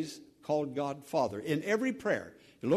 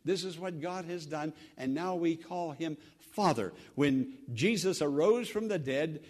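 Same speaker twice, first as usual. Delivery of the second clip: ordinary talk, nothing marked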